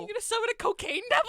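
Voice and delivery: high, loud, fast disbelief; incredulous. Real, not acted. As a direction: ask it in total disbelief. Incredulous question.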